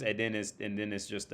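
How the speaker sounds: ordinary talk, nothing marked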